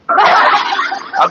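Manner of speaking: words slowed through laughter; real laughing speech. Real, not acted. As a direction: chuckle through the line. Laughing at his own joke.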